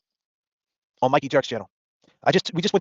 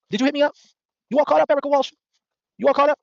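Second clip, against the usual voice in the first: mocking taunt; 10 semitones higher, 3 dB louder than usual. Real, not acted. Taunting Erica Walsh on stream.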